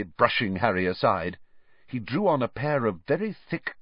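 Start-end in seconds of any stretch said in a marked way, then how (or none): none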